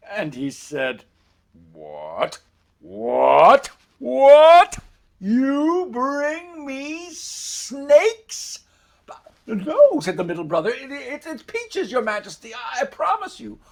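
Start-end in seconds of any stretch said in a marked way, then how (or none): 1.7-8.6 each "what" louder and higher, then stretch the accusation; outraged king. Acted. King lifting the basket of snakes, building to outrage. 9.5-10.0 high, loud cry of denial; panicked protest. Acted. Middle brother denying it to the furious king, panicked. 10.7-13.6 high-pitched, stumbling plea; panicked stammering. Acted. Middle brother swearing to the king it's peaches, panicked.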